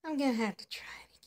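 fast whisper to herself; quick whispered aside. Acted. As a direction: whisper it under your breath, determined. Whispering while trying the drawer.

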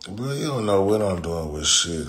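usual speaking voice

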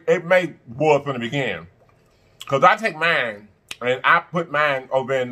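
normal talking voice

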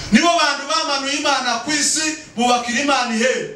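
high-pitched sermon proclamation; impassioned preaching. Real, not acted. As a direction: proclaim it to the congregation. Preaches at full force.

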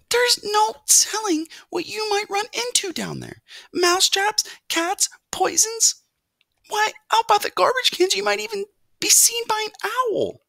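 very high, dramatic warning; alarmed mother mouse. A narrator's reading. Mother warns son of downstairs dangers, alarmed. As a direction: pile up the dangers in a fretful high voice.

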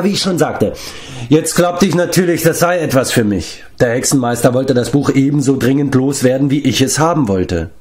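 high-pitched, animated storytelling giant; talkative giant Glev. Acted. (redseliger Riese, lebhaft erzählend)

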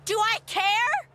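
slow, loud defiant yell; angry. Acted. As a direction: bite each word out slowly. Daughter yells at stressed mom.

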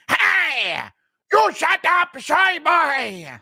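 high drawn-out bully yell; Yoel Romero. Acted. Bully shoving a nerd into a locker, high yelled threat. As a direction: cut in with a strained high shout. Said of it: "Impression of Yoel Romero shoving Ryan Hall into a school locker".

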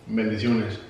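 ordinary delivery

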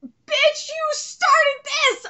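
drawn-out high yell. Real, not acted. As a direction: shout it slowly at her. Yelling at character again.

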